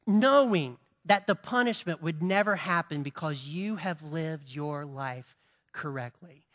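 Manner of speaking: usual speaking voice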